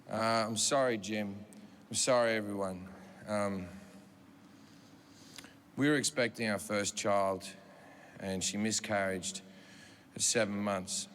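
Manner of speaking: low, halting, apologetic voice; hushed, heavy-hearted apology; subdued grieving husband. Retold or imitated.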